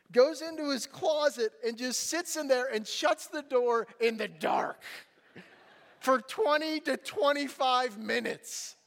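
ordinary talk, nothing marked

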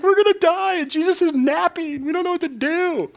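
high, panicky squeal; hammed-up terror in a storm; mock panic. Disciples waking Jesus in the storm, high mock panic.

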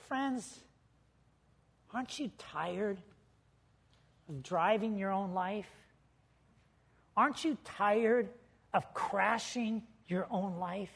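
soft, tender plea with long pauses; gentle appeal. Real, not acted. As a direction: hush down and appeal to weary friends.

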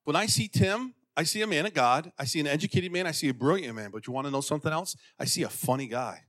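ordinary talk

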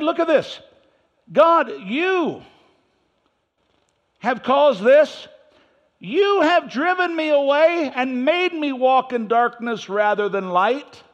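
high, slow, loud complaint to God; whining prophet. Acted. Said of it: "He's still whining".

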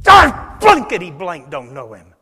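furious shout, dropped low; cursing shout. Acted. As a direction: bellow the curse at them. Peter curses in denial.

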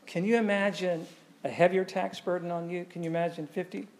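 normal talking voice

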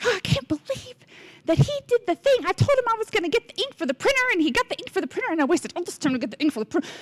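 high-pitched, rapid comic griping; mock frustrated rant. Wife venting about her husband's printer ink errand, mock frustrated rant. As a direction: rattle off the grievance in exaggerated exasperation. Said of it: "sometimes he frustrates me".

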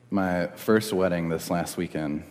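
ordinary talk, nothing marked

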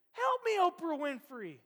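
hammed-up cry for help; frantic yell. Preacher acts out Ricky Bobby's last panicked shout. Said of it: "And finally".

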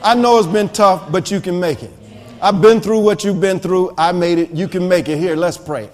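low, soft, reassuring voice; gentle encouragement. Acted. Believer consoling a struggling person before praying, gently encouraging. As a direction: comfort someone quietly, pitched well down. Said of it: "a person just needs somebody to say".

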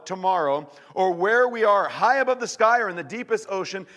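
loud, high, impassioned scripture reading; preaching scripture. Acted. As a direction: proclaim it to the whole room. Preacher reads scripture at full force.

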